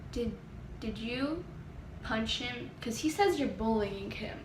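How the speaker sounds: quiet, restrained mock-stern questioning; imitated nurse, a touch angry. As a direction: confront the child softly and haltingly. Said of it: "gave me this angry look".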